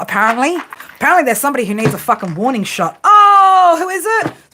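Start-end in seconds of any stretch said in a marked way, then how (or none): 3.0-4.3 sudden high, loud yelp of surprise; a real startled outburst. Real, not acted. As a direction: jump at what just showed up. Sudden startled reaction to card.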